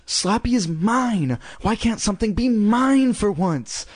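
high-pitched, drawn-out sulky protest; complaining. A narrator's reading. Girl telling her mother the dummy is hers, complaining.

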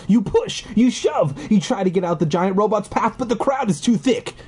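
ordinary talk

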